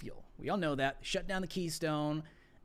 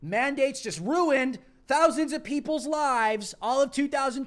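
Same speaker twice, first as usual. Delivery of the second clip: angry rant; 5 semitones higher, 6 dB louder than usual. Real, not acted. Ranting about lost jobs.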